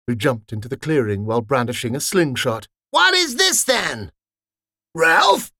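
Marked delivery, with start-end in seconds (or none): 2.9-4.1 much higher, bossy challenge; challenging soldier. Acted. Soldier confronting intruders. 4.9-5.5 higher, louder rising question; both recognise him at once; surprised. Acted. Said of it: "said in unison".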